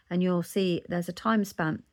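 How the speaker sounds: ordinary talk, nothing marked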